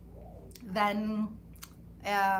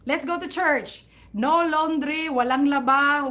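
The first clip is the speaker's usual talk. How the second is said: louder, higher, firm father's command; insistent father; 4 semitones higher, 7 dB louder than usual. Retold or imitated. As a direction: lay down the law like her father.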